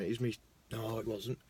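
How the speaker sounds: ordinary delivery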